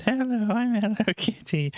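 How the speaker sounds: high, cute cartoon kitty voice; squeaky little kitty greeting; Hello Kitty voice.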